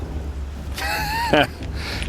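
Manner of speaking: a short, amused chuckle; laughing at the wrong assumption; hearty laugh. Real, not acted. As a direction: laugh off the misunderstanding.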